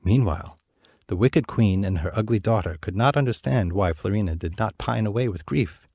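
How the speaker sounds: ordinary delivery, nothing marked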